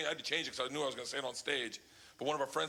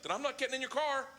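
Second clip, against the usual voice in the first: put-on high stubborn child voice; a little girl refusing outright; a high, defiant kid's refusal; 8 semitones higher than usual. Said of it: "She's like".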